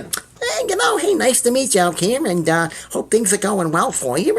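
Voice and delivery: high, breathy start, then a lifted put-on celebrity voice; Jay Leno impression. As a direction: play the talk-show host brushing off a fan. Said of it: "And he was like".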